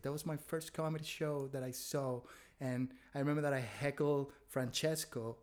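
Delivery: ordinary talk, nothing marked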